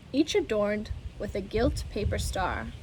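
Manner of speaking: plain reading voice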